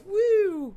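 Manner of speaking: mock yelp. Mimics turbulence jolt.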